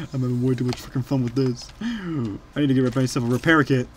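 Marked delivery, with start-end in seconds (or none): none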